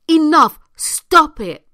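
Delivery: loud, firm command; forceful shout. Real, not acted. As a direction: shout it like a final warning. Urging viewers to stop letting others.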